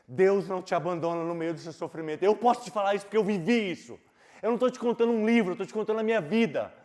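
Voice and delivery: loud, high preaching climax; roaring pulpit proclamation; full-force preaching. Acted. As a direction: shout hope over the church.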